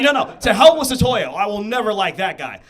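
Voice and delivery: loud, hammed-up fatherly outrage; angry dad. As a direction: burst out as a stubborn, outraged dad. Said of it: "racist dad, like, arguing with his wife".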